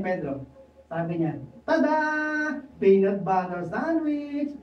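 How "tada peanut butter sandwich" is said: slow, drawn-out proud announcement; proud reveal. Acted. Pedro proudly reveals his sandwich to Juan, drawn out. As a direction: present it like a magic trick.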